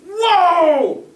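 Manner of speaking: loud, high mock outburst; hammed-up furious parental yell; parent blowing up. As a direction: explode like an outraged parent. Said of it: "kind of blow up and be like".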